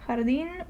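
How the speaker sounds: soft "Jardín," opening on a rough H; J as flu H. Shown as a demonstration. Teacher repeating the example, "Jardín," with throaty H. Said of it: "you pronounce it as the flu H".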